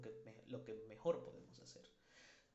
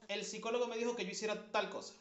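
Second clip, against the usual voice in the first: mock eager patient; 8 semitones higher, 5 dB louder, faster than usual. Paciente lo cuenta al mundo.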